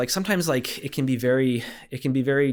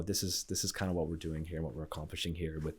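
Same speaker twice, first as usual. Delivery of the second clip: whispering; 5 semitones lower, 11 dB quieter than usual. Retold or imitated. Inner voice during quote.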